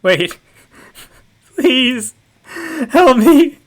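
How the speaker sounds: frantic terrified calling out; panicked cry for help. Acted. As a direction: scream for help as if in danger.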